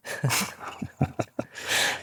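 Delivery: soft chuckling laugh; quiet run of chuckles. Real, not acted. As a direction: laugh softly at the punchline. Laughs after the punchline.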